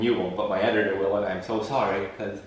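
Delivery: ordinary delivery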